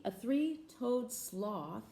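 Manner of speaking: drawn out, slow as a sloth. Shown as a demonstration. Reading to children, mimicking the sloth slowly. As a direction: stretch each word out lazily. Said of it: "They move very slowly".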